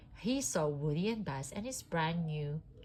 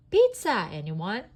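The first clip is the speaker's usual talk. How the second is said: Potato Head sly, pointing out the pizza van; slyly; 3 semitones higher, 10 dB louder than usual. A narrator's reading. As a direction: sell the idea with a sneaky grin. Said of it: "he said slyly".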